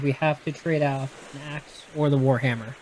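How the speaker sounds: ordinary talk, nothing marked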